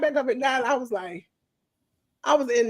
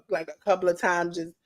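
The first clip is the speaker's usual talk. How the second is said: ordinary talk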